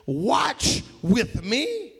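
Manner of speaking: very slow, weighted "watch with me"; emphatic. Acted. Jesus to the men meant to pray, emphatic. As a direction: stretch "watch" and "me", each set apart. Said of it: "it's almost like an emphatic".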